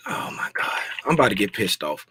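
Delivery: fast whispered frustration under his breath; exasperated whisper. Real, not acted. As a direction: hiss it to yourself, fed up.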